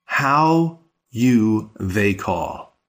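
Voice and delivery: slow, word by word, evenly spaced; slow literal gloss. Shown as a demonstration. Teacher glossing the question word by word, slowly. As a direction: read the word-for-word version slowly. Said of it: "She's literally asking".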